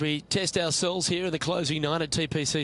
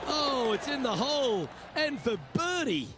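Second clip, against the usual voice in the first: excited; 9 semitones higher than usual. Acted. Ball drops for birdie.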